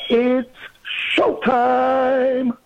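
high, drawn-out showtime call; showman's catchphrase. Pretend. Caller hyping himself as the greatest, high showman's call. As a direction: belt it out high like a hype man.

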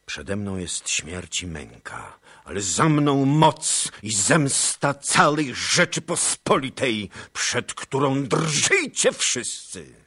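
slow, rising to a high loud warning; solemn threat. A narrator's reading. Noble warning the Cossack council, slow grand threat. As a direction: let the Commonwealth's vengeance ring out.

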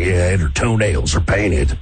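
low, slow mocking imitation; imitating previous caller. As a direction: mimic the caller, dropped low and slowed down. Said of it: "I think the last guy had a foot fetish".

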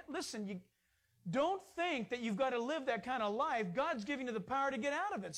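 ordinary talk

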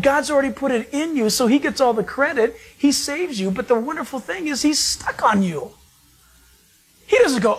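ordinary talk

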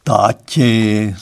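ordinary delivery, nothing marked

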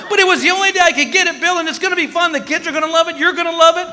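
high, hurried wife's coaxing; pleading wife. Retold or imitated. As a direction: talk him round in a high voice. Wife pleading her case for outing.

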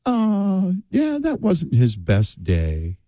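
drawn-out, hesitant "uh"; mock excusing. Party defender excusing a tattooed candidate, mock hedging. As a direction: hedge it like a lame excuse.

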